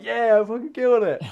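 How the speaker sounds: loud, high victory yell; hammed-up boast; mock triumph. Killer after slitting the sheep's throat, mock triumphant shout.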